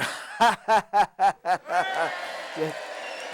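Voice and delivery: real chuckle after the punchline; laughing at own joke. Real, not acted. Leprechaun cracking up at his own joke, real laugh. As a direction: laugh at your own gag.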